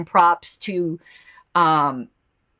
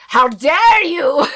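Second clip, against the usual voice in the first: mock-outraged shout in a poor Russian accent; badly faked Russian accent, lathered up; 7 semitones higher, 7 dB louder, much slower than usual. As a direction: draw it out loud and high, hammed-up Russian. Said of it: "I don't do a good Russian accent".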